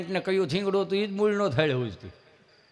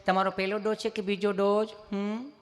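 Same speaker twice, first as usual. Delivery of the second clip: put-on sweet, evenly intoned nurse; imitated nurse's caring questioning; gentle nurse; 2 semitones higher, 4 dB quieter than usual. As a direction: ask softly and lovingly, ending on a little hum. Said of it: "સહજતાથી પૂછતા હોય એમ જ પૂછે".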